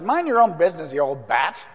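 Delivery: mock retort. Preacher imagining telling the pushy mother off, mock retort. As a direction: fire it back like a cheeky teenager. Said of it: "I might have said".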